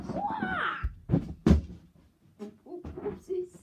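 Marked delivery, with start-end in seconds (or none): 0.1-0.9 high hoo-ah battle cry; martial arts yell. Acted. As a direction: shout hoo-ah as you strike. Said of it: "take 'em".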